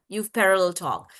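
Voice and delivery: ordinary delivery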